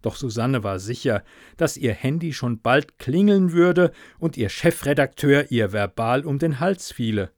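ordinary delivery

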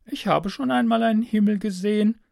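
put-on high girlish voice, offhand; casual girl. A narrator's reading. Girl mentions a sky to stranger, casual high voice. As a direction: toss it off casually in a girl's high voice. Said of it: "sagt sie beiläufig".

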